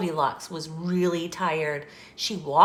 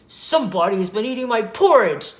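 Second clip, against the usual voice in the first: loud, fast, growly bear voice; Papa Bear's loud growl; 3 semitones higher, 9 dB louder, faster than usual. A narrator's reading. Papa Bear finds his porridge eaten, loud growl. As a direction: growl it out loudly like a big bear. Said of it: "loud, growly voice".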